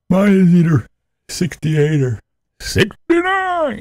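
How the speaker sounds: high, slow sing-song; high silly voice. Acted. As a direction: say it in a high squeaky voice. High playful voice on thermostat.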